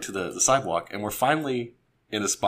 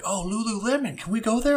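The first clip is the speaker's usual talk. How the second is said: high, pleading imitation of his girlfriend; mock girlfriend voice; 12 semitones higher than usual. Boyfriend mimics girlfriend begging to visit shop, high mock plea. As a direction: chirp it like an eager shopper.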